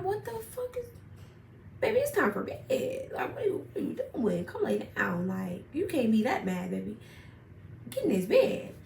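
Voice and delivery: sing-song coaxing, pitched well up. Retold or imitated. Calling boyfriend to bed.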